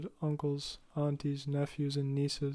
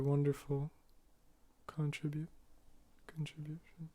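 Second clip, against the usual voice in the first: swallowed words; 8 dB quieter than usual. Real, not acted. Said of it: "He, he eats his words".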